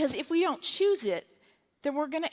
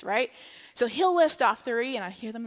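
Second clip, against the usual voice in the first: ordinary talk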